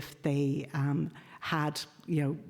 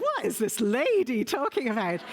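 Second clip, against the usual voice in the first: higher-pitched mock bafflement; baffled scholars; 7 semitones higher, 4 dB louder than usual. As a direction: imitate scholars reacting in disbelief. Mimics baffled Kafka scholars.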